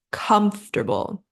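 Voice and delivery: COMFORTABLE in three syllables, COMF-ter-bul; correct COMFORTABLE. Shown as a demonstration. English teacher introduces the first word, correct COMFORTABLE as KUMF-ter-bul. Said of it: "that's not quite right".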